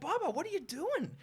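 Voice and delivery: high-pitched, disbelieving question; incredulous protest. Retold or imitated. Bookkeeper to cafe owner after customer leaves, high incredulity. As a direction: exclaim it in mock dismay.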